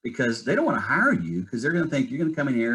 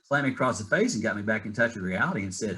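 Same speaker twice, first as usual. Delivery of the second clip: usual speaking voice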